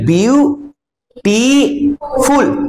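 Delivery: "Beautiful" slowly as বিউ, টি, ফুল with long pauses; split into syllables. Shown as a demonstration. Teacher counting syllables for class, slow and divided. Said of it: "তিনটে syllable আছে এর".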